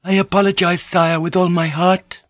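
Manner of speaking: high, eager apologizing; high-pitched anxious contrition; gushing apology. A narrator's reading. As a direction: pour out a humble apology in a high voice. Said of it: "Fujiko said quickly, the words gushing".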